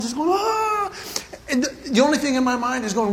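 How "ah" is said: high strained nervous ah; a rising anxious ah; nervous gasp. Retold or imitated. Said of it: "I am physically nervous".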